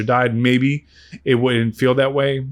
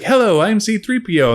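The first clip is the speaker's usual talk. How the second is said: high, cheery C three P O voice; bright, polite droid introducing itself; chipper robot greeting; 9 semitones higher than usual. Retold or imitated. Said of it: "He's like".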